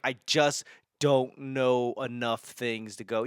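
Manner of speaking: ordinary talk, nothing marked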